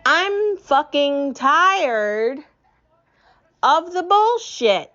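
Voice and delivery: a real, drawn-out fed-up cry; fed-up outburst. Real, not acted. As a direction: spit it out, sick of it all. Climax of angry rant.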